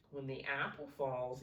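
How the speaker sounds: ordinary delivery, nothing marked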